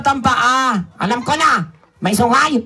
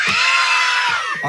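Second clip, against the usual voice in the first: much higher, loud shouted hi; louder hi. Pretend. Puppet told to be louder, shouting hi to the kids. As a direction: belt the greeting out as loud as you can. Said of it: "Lakasan nyo".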